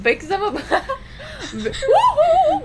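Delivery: very high, loud cartoon catchphrase through laughter; hammed-up singsong cartoon greeting, laughing; mimicking Frozen character. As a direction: hoot it in a drawn-out falsetto. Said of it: "What's the one in Frozen?".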